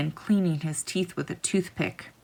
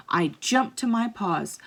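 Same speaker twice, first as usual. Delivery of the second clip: ordinary delivery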